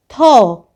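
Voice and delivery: थौ said loud, aspirated th with an open au; clear au sound. Shown as a demonstration. Teacher sounding out थौ for pupils, loud and clear. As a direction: give थौ a strong, clean au. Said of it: "और औ की मात्रा".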